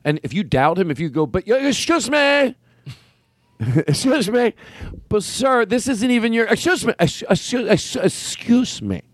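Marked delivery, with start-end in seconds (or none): 1.3-9.0 slow, high, drawn-out "Excuse me" with mock offense; pushy usher character. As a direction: stretch "Excuse me" like you're deeply offended. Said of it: "This is a character that I made up".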